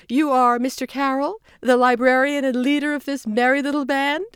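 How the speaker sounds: high, loud bright woman's voice; a chirpy official welcoming guests; Margie voice. A narrator's reading. Margie greeting the librarian.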